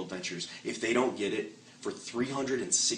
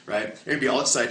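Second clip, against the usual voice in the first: usual speaking voice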